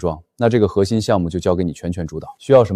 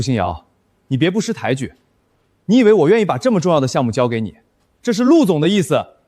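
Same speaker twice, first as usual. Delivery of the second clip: fast, higher, exasperated retort; irritated snap; 6 semitones higher than usual. Acted. Manager snapping at the assistant to accept the project. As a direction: shut her down impatiently.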